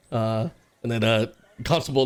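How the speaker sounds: ordinary talk, nothing marked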